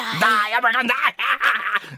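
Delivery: high, slow villain threat. Shown as a demonstration. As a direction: drag out a hammy villain threat. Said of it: "la voz del villano".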